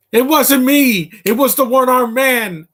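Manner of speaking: high, loud quoted protest; mock desperate denial. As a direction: cry it out like a hammed-up wronged man. Acts out a famous movie line.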